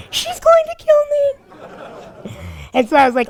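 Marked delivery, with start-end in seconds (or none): 0.1-1.3 squeaky high mock panic; mock afraid. Laughing fear after the girl storms off. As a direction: squeal it up high like you're scared. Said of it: "all afraid".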